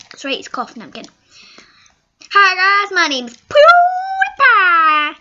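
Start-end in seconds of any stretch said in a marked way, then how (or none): 2.3-5.2 loud, high YouTuber intro, name stretched out; PewDiePie. Retold or imitated. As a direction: holler the name in a drawn-out high cry. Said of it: "Guess who this guy is?".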